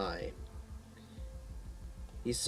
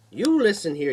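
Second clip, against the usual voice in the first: scolding nurse; 7 semitones higher, 7 dB louder than usual. Acted.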